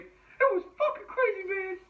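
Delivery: very high-pitched put-on voice; Santa impression. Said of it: "the Sand from Shazam moment".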